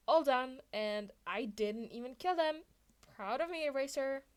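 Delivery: loud, upbeat boast; proud and playful; cheerful Mike. A narrator's reading.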